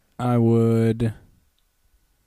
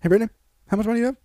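rapid, pitched-up question; very fast high voice; 9 semitones higher, much faster than usual. Retold or imitated.

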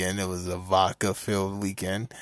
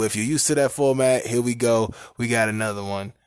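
ordinary talk, nothing marked

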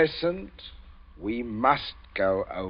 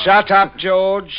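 much louder, higher roar; furious shout; 8 semitones higher, 14 dB louder, much slower than usual. Acted. Father roaring at his sniggering son. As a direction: blast him into silence.

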